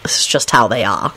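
low, shrugging apology; resigned, easygoing apology; apologetic. A narrator's reading. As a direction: excuse them mildly, pitched low. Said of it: "Lupin shrugged apologetically".

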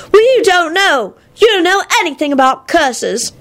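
put-on high-pitched childish retort; petulant child. A narrator's reading. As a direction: talk back like a sulky little kid. Sulky child talks back.

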